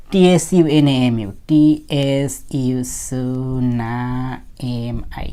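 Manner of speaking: letters drawn out one at a time; teacher's deliberate letter-by-letter spelling; slow spelling-out. Shown as a demonstration. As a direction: spell it very slowly, stretching each sound. Teacher spells out tsunami slowly.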